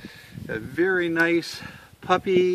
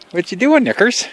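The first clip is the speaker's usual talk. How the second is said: pet talk; 8 semitones higher, 10 dB louder, faster than usual. Real, not acted. Calling to the dog.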